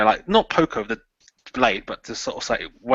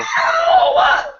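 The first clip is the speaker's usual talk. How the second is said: loud, high, real laugh; burst of laughter; 8 dB louder than usual. Real, not acted. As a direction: crack up at your own coldness. Player laughs at his own act.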